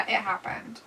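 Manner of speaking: normal talking voice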